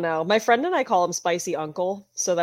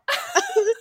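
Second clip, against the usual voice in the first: very high helpless laugh; real laughter. Real, not acted. Friend remembering a shared joke, helpless high laughter. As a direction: dissolve into high giggles.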